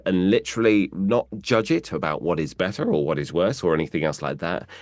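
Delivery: ordinary delivery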